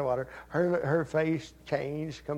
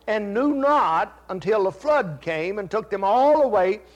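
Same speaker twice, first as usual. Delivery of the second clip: loud, slow preaching of scripture; fervent Bible reading; 3 semitones higher, 6 dB louder than usual. Acted. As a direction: proclaim the verse to the church. Reads scripture.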